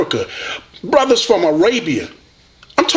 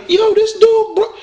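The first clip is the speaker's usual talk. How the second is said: hyped-up fan. Retold or imitated. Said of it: "they was like".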